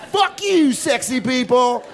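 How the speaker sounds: high-pitched laughing yell; laughing mock shout. Mock yelling at summer people.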